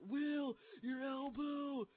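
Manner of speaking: high, drawn-out cry of alarm; alarmed call. Retold or imitated. Teammates calling out to an injured player, high drawn-out mock alarm. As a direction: imitate teammates yelling in shock, stretching the words.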